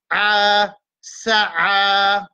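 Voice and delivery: "sa'a" with the last a held long and level; spelled-out pronunciation. Shown as a demonstration. Teacher showing how to read sin, ain, alif, "sa'a" drawn out. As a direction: sustain the final a of "sa'a". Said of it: "nous vous disons sin fatha sa".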